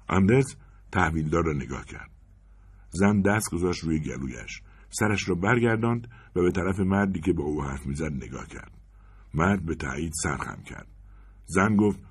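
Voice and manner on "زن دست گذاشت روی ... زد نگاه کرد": plain reading voice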